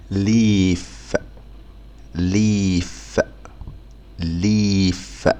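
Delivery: ليف slowly with long ee; slow leaf. Shown as a demonstration. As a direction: draw out ليف. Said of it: "ممدودة". (ليف بمد واضح)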